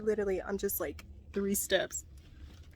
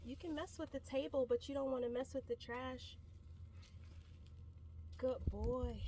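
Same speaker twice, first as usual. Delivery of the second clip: softer, calm coaxing; gentle horse talk; 10 dB quieter than usual. Real, not acted. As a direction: murmur to the horse to keep it calm.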